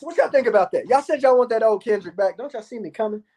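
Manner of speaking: ordinary talk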